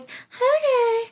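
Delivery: sing-song agreeable okay; hammed-up sweet okay; mock chirpy okay. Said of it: "And she's like".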